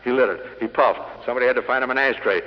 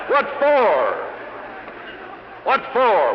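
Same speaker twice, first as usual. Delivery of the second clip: exasperated shout; 9 semitones higher, much slower than usual. Real, not acted. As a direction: fling the question at the room twice. Repeated rhetorical demand.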